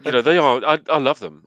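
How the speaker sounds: laughing loudly through the words; words tumbling out between laughs; laughing while talking. Real, not acted. Laughing to the co-hosts while praising the films.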